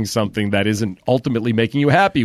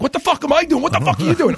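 high-pitched indignant New York comeback; retold New Yorker outburst, pitched way up; New York attitude; faster than usual. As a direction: fire it straight back, high and indignant. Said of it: "my New York attitude comes out".

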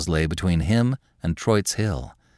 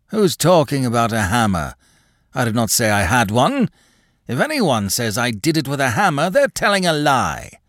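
put-on old man turning suspicious; defensive old man; 5 semitones higher than usual. A narrator's reading. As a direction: deny it with a sly edge. Craven's cunning denial.

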